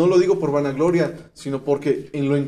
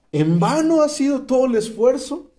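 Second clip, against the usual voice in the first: ordinary talk, nothing marked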